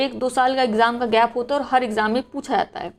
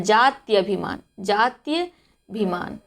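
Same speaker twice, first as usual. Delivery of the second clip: slow careful pronunciation; 2 semitones lower, much slower than usual. Shown as a demonstration. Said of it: "नहीं होता बल्कि क्या होता है".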